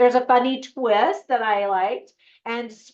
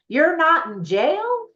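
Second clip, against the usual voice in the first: high, slow, disbelieving "not in jail"; mildly acted shock at the news; surprised question; 7 semitones higher, 3 dB louder, slower than usual. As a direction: ask it amazed, drawing it out. Sandy surprised at freed relative.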